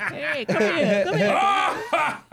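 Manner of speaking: high, slow laughing call; creepy mock call. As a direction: drag it out, high and leering. Mocking grabbing old lady.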